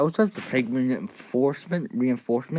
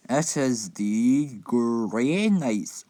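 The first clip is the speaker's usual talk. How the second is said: dramatic announcer; much slower than usual. Acted. Dramatic slowed box announcement.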